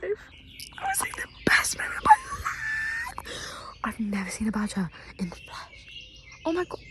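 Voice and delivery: thrilled hushed whisper pushed hard on best moment; excited whisper. Real, not acted. Vlogger thrilled after seeing a badger, excited whisper. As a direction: whisper your amazement without a sound.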